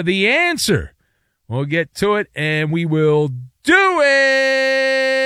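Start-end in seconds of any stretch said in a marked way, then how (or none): 3.6-5.2 drawn-out tease. Real, not acted. Host teasing trivia answer before the break, drawn-out. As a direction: stretch the words out long, higher.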